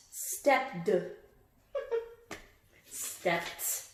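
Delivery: ordinary talk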